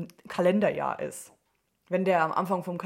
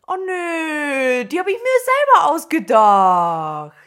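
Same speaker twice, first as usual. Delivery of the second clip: mock dismay; 7 semitones higher, 10 dB louder, much slower than usual.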